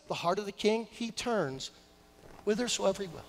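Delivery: suddenly soft recitation; hushed scripture quote. Real, not acted. Pastor quoting his Bible on the king's heart, hushed. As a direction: drop right down for the verse.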